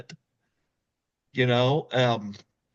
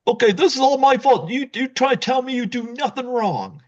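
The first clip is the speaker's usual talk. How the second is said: frantic pleading; 11 semitones higher than usual. Retold or imitated. Dewey chasing his wife, a high frantic plea. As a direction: rush it out high like a caught husband.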